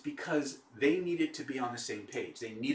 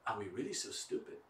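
softer, deflated question; defeated; 3 semitones lower, 9 dB quieter than usual. Retold or imitated. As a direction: let all the fight drain out. Defeated man finally concedes.

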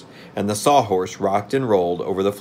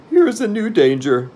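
high, loud voice of gloomy Jack; worried, high-pitched character voice; 13 semitones higher, 7 dB louder than usual. A narrator's reading. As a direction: pitch it way up, full of foreboding. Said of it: "remarked Jack gloomily".

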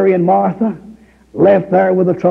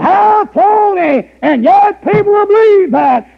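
preaching at full force; 9 semitones higher than usual. Acted. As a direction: drive it home from the pulpit.

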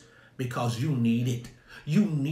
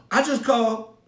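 put-on high, loud voice of a nervous young son; son's voice; 8 semitones higher, 5 dB louder than usual. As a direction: play the son caught out, pitched up. Son answers his dad.

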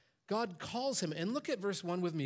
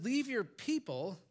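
"Leave your people" drawn out slowly, higher; God telling Abram to leave his people; slow command; 5 semitones higher, slower than usual. Acted. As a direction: lean on "Leave" like God's order. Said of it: "God says".

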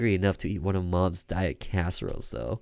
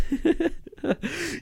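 very high, rising laugh; mock yourself with a shrill laugh. Mocking laugh.

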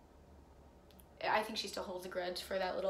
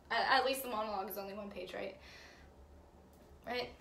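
ordinary delivery

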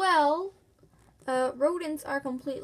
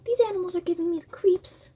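ordinary talk, nothing marked

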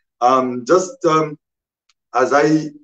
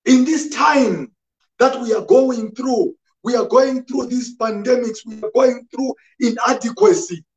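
high-pitched, insistent sermon delivery; raised pulpit voice pressing the point home; impassioned preaching; 11 semitones higher than usual. Real, not acted. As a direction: preach it urgently, building by repeated phrases. Preaches to congregation.

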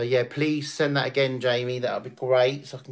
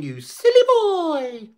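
high, loud squeal on silly; playful squeal; 6 dB louder, slower than usual. Shown as a demonstration. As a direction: tease the cat in a high squeal. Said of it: "Call Callum Finn a silly boy".

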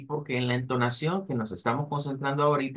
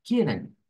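"Kitten" starting high and dropping sharply; falling at the end; 3 semitones higher than usual. Shown as a demonstration. Teacher shows the sentence's final drop, falling tune. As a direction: let the pitch slide down through "Kitten". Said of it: "ahí bajaríamos al final".

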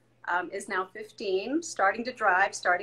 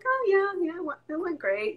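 breezy nonchalance; 8 semitones higher than usual. Retold or imitated. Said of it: "always cool as a cucumber".

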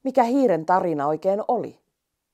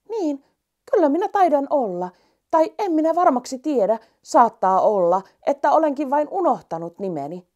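higher, small, unsure mouse; little mouse voice; 8 semitones higher, 4 dB louder than usual. Acted. Mouse telling the rainbow it has no name, high and unsure. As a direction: play the tiny mouse who forgot its name.

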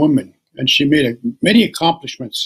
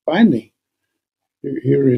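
ordinary delivery

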